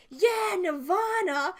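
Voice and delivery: slow, high, loud drawn-out cheer; stretched-out fan cheer; excited cheer. Retold or imitated. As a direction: whoop it like a fan hearing their song. Cheering at festival tent.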